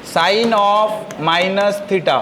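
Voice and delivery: very slow, each word stretched; slow dictation. Shown as a demonstration. Teacher giving the first formula, slow dictation. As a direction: spell out the formula at writing speed.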